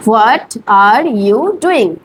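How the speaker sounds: What are you doing? drawn out for repeating; teacher modelling a sentence for repetition; slow model phrase. Shown as a demonstration. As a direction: say it slowly so students can copy. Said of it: "আমার পরে বলুন".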